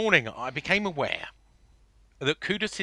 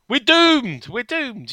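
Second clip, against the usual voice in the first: mock doom; 10 semitones higher, 9 dB louder, much slower than usual. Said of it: "as people on X Twitter have gone".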